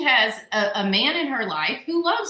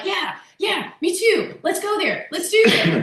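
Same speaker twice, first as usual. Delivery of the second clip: high, eager rallying voice; fired up; 8 semitones higher than usual. Acted. Listener spurred on by someone's courage, fired up. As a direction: whip the crew into action, pitched high. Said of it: "all fired up".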